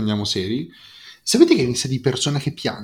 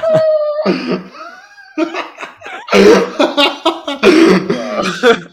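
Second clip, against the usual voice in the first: loud high-pitched cackle; high shrieking laugh; 8 dB louder than usual. Real, not acted. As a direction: burst out laughing, shrill. Burst of laughter.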